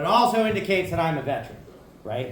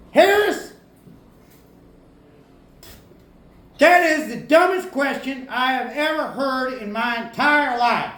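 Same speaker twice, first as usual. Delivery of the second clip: put-on thick South Carolina accent; 6 semitones higher than usual. Sergeant scolds the private about babies, in a thick South Carolina accent. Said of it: "in his thick South Carolina accent".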